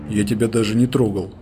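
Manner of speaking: usual speaking voice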